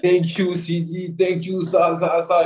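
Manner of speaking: high-pitched mock thanks; mock-grateful, high singsong teasing; mimicked fan messages. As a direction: imitate teasing fans in a high voice. Mimics mocking messages from fans.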